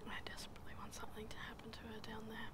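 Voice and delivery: quick hushed whisper; breathy fast whisper aside. Real, not acted.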